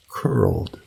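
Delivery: plain reading voice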